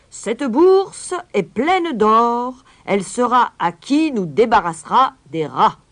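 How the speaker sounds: slow, loud, high proclamation; mayor crying out. Acted. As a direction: announce the reward to the whole square. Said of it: "et cria en la brandissant". (proclamation lente et criée)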